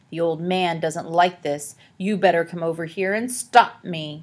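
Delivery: ordinary delivery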